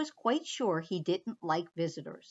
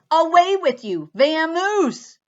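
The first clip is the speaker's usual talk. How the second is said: higher, much louder shout; loud shooing; 7 semitones higher, 12 dB louder than usual. Acted. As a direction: chase the mouse off. Bear shooing the mouse.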